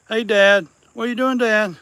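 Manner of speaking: high, loud cat-calling voice; high playful call. Real, not acted. Man calling to the daddy cat playfully. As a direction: holler sweetly at the tomcat.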